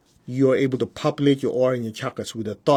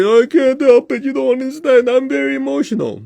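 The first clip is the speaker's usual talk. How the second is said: high, louder, mock self-pity; whiny complaint; 12 semitones higher, 6 dB louder than usual. Teacher mimics students who cling to emotions, high whiny. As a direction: play the self-pitying student. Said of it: "as long as you say".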